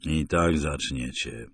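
mutter through teeth. A narrator's reading. Narrator answering the gunmen, low slow mutter. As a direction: grumble it through gritted teeth. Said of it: "mruknąłem przez zaciśnięte zęby".

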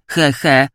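brief mocking student chuckle; acted little laugh; short chuckle. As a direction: chuckle at your own jibe. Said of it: "zaśmiał się ten pierwszy".

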